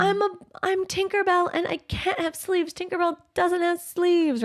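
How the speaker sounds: put-on high, whiny little kid. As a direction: whine like a stubborn child. Child whining about her costume.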